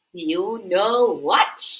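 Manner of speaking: slow, mysterious tortoise voice; slow it down, tease a secret. A narrator's reading. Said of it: "He said".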